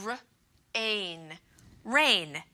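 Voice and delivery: very slow, r-ain pulled apart; slowly sounding out r-ain. Shown as a demonstration. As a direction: segment the word carefully. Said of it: "We mark her silent".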